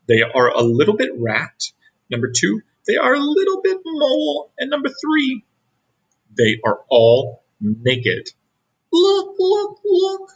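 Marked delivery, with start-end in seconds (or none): 2.9-5.4 high squeak. A narrator's reading. Squeaky aside in story. 8.9-10.3 very high, eager look look; squeaky excited calling. A narrator's reading. Excited pointing.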